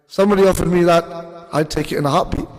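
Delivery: ordinary talk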